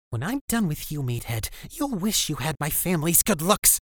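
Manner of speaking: put-on high, sneering teen girl; angry girl voice. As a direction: spit the threat at him. Elizabeth threatens Marcus angrily.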